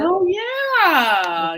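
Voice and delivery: thrilled at the name; excited recognition. Real, not acted. Hearing a favourite book named, excited cry.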